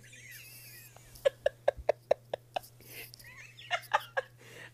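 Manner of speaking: giggly high laugh, breathless; long high giggle. Real, not acted. Confessing a crush on a good dad, giggling high. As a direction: let out a long squeaky giggle.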